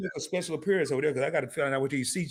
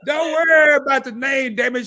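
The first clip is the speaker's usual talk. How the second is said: loud, high, half-laughing outburst; cracking up while shouting it down; 10 semitones higher, 7 dB louder than usual. Real, not acted. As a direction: yell it through the giggles. Shouting over laughter at name.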